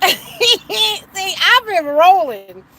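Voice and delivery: high, loud laughter through the words; can't stop laughing at the memory. Real, not acted. To the viewers about Seven's remark, laughing hard.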